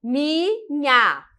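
"minha" in two separated beats; split into syllables. Shown as a demonstration. Teacher showing syllable division, "minha" broken in two. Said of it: "com a separação silábica gramatical".

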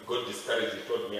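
ordinary delivery

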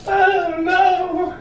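high, slow operatic woe; mock tragic lament. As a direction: wail it like lost love.

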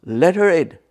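booming imitation of an official; manly big voice. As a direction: call it out like the big boss. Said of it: "And I hear him say".

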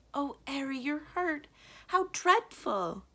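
high-pitched dismay, drawn out at the end; distressed concern. A narrator's reading. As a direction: fuss over a hurt loved one. Belladonna sees the magician's burnt hand.

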